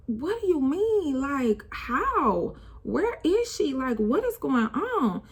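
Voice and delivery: high, pleading questions; high distressed disbelief; frantic disbelief. Retold or imitated. Retelling her reaction to the news.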